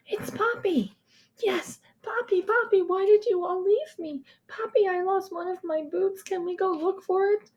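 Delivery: put-on high, eager little-girl voice, calling out to Poppy; a small girl clinging to hope; relieved child calling. A narrator's reading. Little girl calls to her father after the crash, high and eager.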